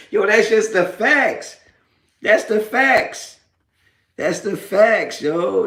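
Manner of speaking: high, slow gloating. Real, not acted. Gloating at trolls about getting paid, slow high smugness. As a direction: lean on it, smug.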